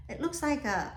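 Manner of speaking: usual speaking voice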